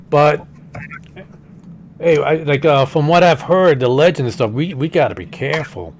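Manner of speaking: easygoing New York guy talking; Brooklyn accent. Acted. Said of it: "This is more of a Brooklyn accent".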